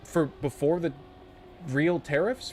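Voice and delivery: normal talking voice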